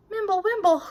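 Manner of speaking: usual speaking voice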